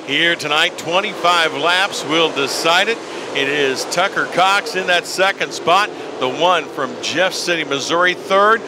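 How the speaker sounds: rising excitement as a driver charges; motorsport commentator. Acted. Live race play-by-play call.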